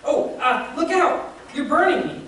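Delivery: high, loud cry of pain; pained yelp. A narrator's reading. The ghost cries out as the angel burns him, loud and high. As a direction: yelp as the heat hits you.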